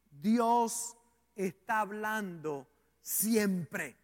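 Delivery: very slow, deliberate and measured; slow, weighty statement. Real, not acted. Preacher asking listeners to hear well, slow and weighty. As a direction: spread the words out with pauses. Preacher makes key point, slowly.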